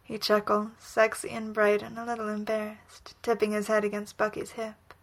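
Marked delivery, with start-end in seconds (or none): none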